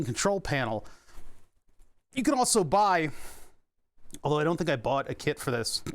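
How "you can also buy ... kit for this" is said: hiccuping. Real, not acted. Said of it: "Hold on, I'm hiccuping".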